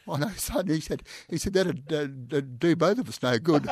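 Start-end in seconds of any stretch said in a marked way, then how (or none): 0.1-0.7 high-pitched squeaky voice, loosely mimicked; squeaky commissioner. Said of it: "he had this high-pitched, squeaky voice". 1.5-3.7 high, loud squeaky imitation; squeaky commissioner. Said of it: "squeaky, squeaky voice".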